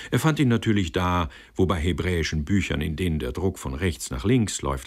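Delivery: ordinary delivery, nothing marked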